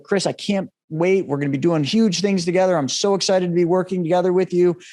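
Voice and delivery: imitating an excited, upbeat caller; cheerful. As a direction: play the thrilled friend who can't wait. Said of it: "he's so cheerful. He's so happy".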